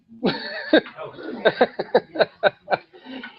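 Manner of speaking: light rising laugh; high laugh. Real, not acted. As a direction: laugh at the shy one. Laughing at shy board member.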